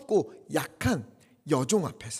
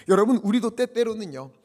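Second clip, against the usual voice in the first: normal talking voice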